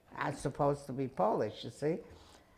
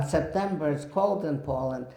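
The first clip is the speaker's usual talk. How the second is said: ordinary delivery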